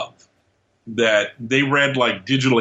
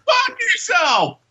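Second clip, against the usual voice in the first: high, slow shouted curse; angry shout; slower than usual. Pretend. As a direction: yell it in mock rage. Echoes friend's angry retort.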